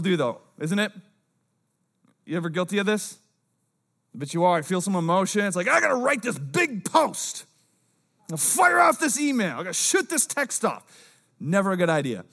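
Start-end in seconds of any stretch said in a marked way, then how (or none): 5.7-10.8 mock rash outburst. Mimicking rash emotional posting.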